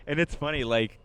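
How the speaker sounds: normal talking voice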